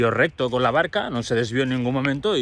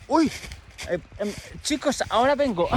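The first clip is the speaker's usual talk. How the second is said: put-on high, hesitant teen voice slipping away; shy Leandro excusing himself; 9 semitones higher, 4 dB quieter than usual. Leandro excusing himself to classmates, shy and high. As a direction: stammer an excuse to leave the class, pitched up.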